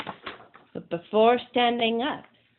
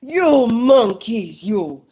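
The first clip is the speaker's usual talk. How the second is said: very slow, loud furious yell; furious shout; 10 dB louder, slower than usual. Acted. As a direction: stamp both feet and shout at them. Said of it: "stamped both his feet and shouted".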